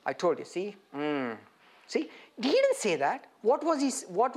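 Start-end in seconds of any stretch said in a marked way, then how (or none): 0.1-2.1 mock reproach. Imagined I-told-you-so from Jesus.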